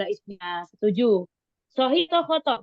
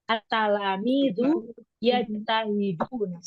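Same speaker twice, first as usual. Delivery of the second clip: slow reading; slower than usual. Shown as a demonstration. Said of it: "pelan-pelan".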